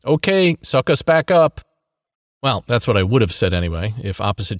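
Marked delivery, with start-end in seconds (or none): 0.0-1.6 loud high call down below; shout. A narrator's reading. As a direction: shout it down to her. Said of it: "shout to Gigi".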